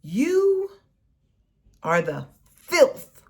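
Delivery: ordinary delivery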